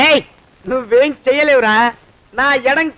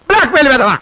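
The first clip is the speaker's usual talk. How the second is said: бlack-mail insult spat out louder; louder angry repeat; 6 dB louder, faster than usual. Acted.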